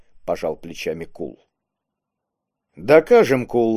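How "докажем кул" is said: ordinary delivery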